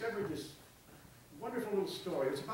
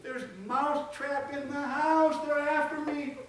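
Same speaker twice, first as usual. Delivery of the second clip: frantic mouse; 10 semitones higher than usual. Acted. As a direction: beg the pig for help. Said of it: "becoming frantic".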